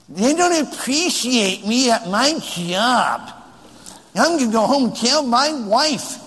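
high, slow, put-on sulk; hammed-up drawn-out complaint; mock pouting husband. Husband off to tell his wife about his job, hammed-up pout. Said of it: "Men don't talk like that".

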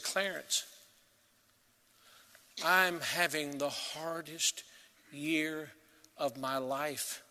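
a hint of a tearful old man, soft and slow; weeping old man. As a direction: quietly voice his grief, lightly. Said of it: "this seventy-something-year-old man was weeping".

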